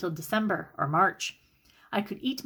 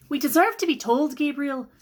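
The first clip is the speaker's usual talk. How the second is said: angry demand; 6 semitones higher, 4 dB louder than usual. Acted. Sister confronts brother.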